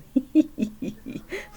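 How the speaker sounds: breathy high giggle; elephant giggle. Acted. Elephant tickled by the joke, breathy giggle. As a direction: let a giggle escape. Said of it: "He began to giggle".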